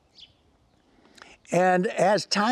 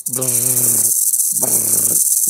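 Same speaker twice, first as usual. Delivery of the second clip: low rolling rattle with the lips; rattlesnake rattle; 7 semitones lower than usual. Retold or imitated. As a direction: buzz a low snake rattle. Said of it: "You hear that rattle".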